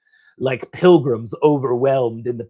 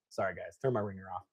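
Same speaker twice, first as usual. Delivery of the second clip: quiet aside; 4 semitones lower, 13 dB quieter, much faster than usual. Real, not acted. Aside after phone interruption.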